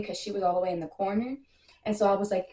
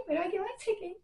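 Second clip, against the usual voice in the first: high but much softer, eager; excited hush; 10 semitones higher, 7 dB quieter, faster than usual. Real, not acted. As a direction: keep it high but drop to a near murmur. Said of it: "I'm super excited".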